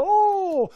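long, high, loud oh; hammed-up crowd reaction; impressed crowd oh. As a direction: draw out an audience's amazed oh.

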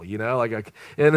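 ordinary talk

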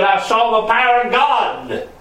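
high, ringing testimony; preacher voicing an awestruck witness; impassioned proclamation. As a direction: proclaim the vision at a high pitch. Prophet proclaiming his message.

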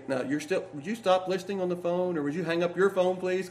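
quiet imitation of a father on the phone; lightly voiced dad. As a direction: softly ask an eavesdropper to hang up. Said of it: "and my dad would say".

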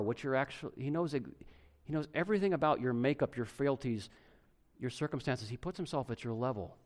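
normal talking voice

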